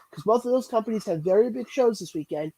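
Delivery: ordinary delivery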